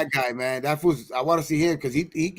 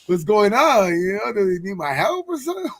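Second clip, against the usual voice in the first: raised, louder mock bewilderment; alarmed reaction; 7 semitones higher, 3 dB louder than usual. Wondering if he needs help, mock alarmed. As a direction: replay the confused alarm, pitched up. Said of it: "I was like".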